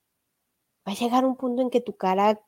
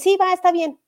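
higher, louder, eager concession; desperate agreement; 7 semitones higher, 5 dB louder than usual. Acted. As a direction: give in at once, desperate to be forgiven. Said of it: "estás tan desesperado porque te perdonen".

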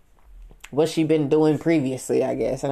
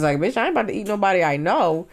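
ordinary delivery

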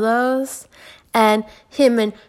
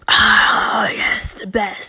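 breathy creeper whisper, savoring it; creepy whisper; much slower than usual. Retold or imitated. Narrator mocking a creepy old texter in a whisper.

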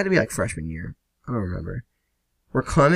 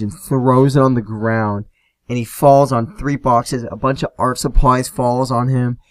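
usual speaking voice

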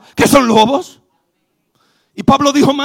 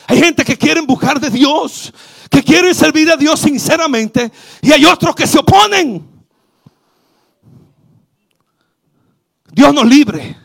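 loud, rising sermon cry; fervent preaching; 4 semitones higher, 5 dB louder than usual. Real, not acted. Pastor warning the congregation about opponents, loud preaching. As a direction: drive the warning home.

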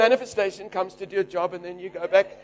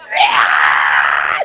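banshee yell; 6 dB louder than usual. Retold or imitated. Woman yells on seeing him.

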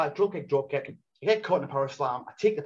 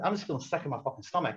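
ordinary delivery

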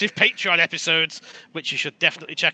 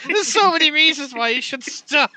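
high, loud, breaking with laughter; laughing plea; 12 semitones higher, 5 dB louder than usual. Real, not acted. Begging the others to stop teasing, laughing. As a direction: beg them to stop while cracking up.

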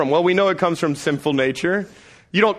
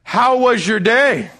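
slow, louder, loaded; knowing question; 7 semitones higher, 5 dB louder, slower than usual. Retold or imitated. Dad asking again, slow and loaded. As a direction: ask it slowly like you already know. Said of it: "I'm like".

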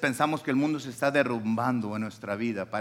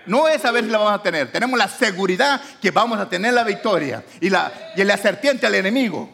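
higher, louder declaration of victory; full-force preaching; 8 semitones higher, 8 dB louder than usual. Acted. Preacher assuring the congregation of victory, fervent preaching. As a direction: proclaim it with certainty.

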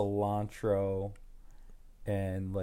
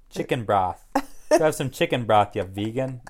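louder, punched Boston accent; 8 dB louder than usual. Shown as a demonstration. Attempting the vegan jab in a Boston accent on request. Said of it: "like, a Boston accent".

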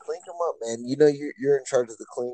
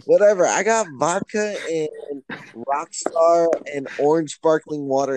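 loud tipsy boast; 5 semitones higher, 6 dB louder than usual. Real, not acted.

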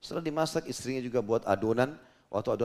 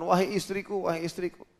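high-pitched call to his wife; mildly panicked calling out; retold anxious call; 6 semitones higher than usual. Jabir runs home calling his wife. (menirukan panggilan cemas bernada tinggi)

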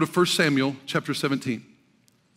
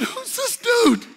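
very high, drawn-out mock disbelief; incredulous David; slower than usual. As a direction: squeal it slowly as a scoffing question. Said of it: "So he said".

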